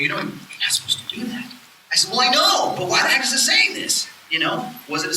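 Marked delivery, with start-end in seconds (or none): 0.0-1.5 hushed whisper to himself; whispered reply. Retold or imitated. Quoting himself answering the thought. 2.1-4.1 higher, louder exasperated protest. Retold or imitated. Exasperated retold reply.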